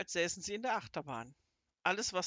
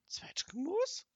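incredulous, much higher, whispered; can't believe the insult, squeak it; indignant echo; 12 semitones higher, slower than usual. A narrator's reading. (ungläubig empört, viel höher, geflüstert)

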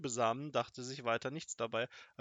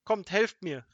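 loud, higher call for help; raised-voice summons; 6 semitones higher, 9 dB louder, slower than usual. A narrator's reading. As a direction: call the others over urgently. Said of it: "rief Kasperl".

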